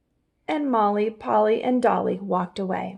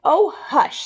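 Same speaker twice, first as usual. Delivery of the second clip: impatient hush; 7 semitones higher, 5 dB louder than usual. Acted. Rabbit impatient.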